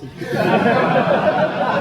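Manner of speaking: high laugh at the cockroach line; real laugh. Real, not acted. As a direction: let a real laugh escape.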